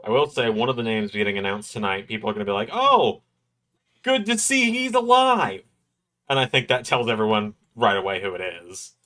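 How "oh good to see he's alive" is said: high squealed surprise, imitating fans; mock surprise. Host imitating fans hearing tonight's announced name, high mock surprise. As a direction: squeal it like an amazed crowd.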